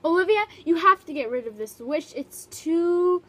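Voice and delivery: loud exasperated scolding; loud frustrated parent. Acted. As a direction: scold her, fed up. Parents upset about snow.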